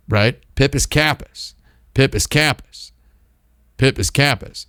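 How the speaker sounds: normal talking voice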